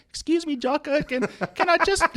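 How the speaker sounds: very high, squeaky put-on voice; timid squeaky imitation. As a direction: mimic a meek interruption way up high. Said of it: "because Jocko's got this deep voice".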